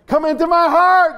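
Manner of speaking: high, loud call into his ear; yelled prayer. Retold or imitated. Preacher yelling the prayer to a deaf man. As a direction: shout each word plainly. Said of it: "I had to yell the prayer to him".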